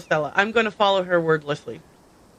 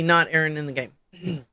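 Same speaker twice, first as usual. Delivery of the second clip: ordinary talk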